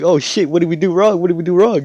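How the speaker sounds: high, loud mock panic; hammed-up frantic questioning; freaking out. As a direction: panic like worried parents. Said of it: "they're, like, freaking out now".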